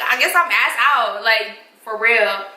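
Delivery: ordinary talk, nothing marked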